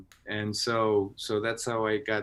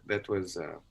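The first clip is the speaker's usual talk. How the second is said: ordinary talk, nothing marked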